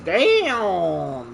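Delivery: usual speaking voice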